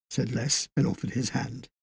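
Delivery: ordinary delivery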